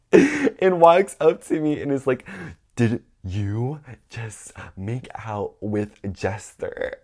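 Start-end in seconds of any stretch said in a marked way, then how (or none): 2.8-7.0 word-by-word confrontation, drawn out; slow, halting, stunned interrogation; slow accusing question. Retold or imitated. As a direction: space out each word as you accuse. First, dramatic take of confrontation.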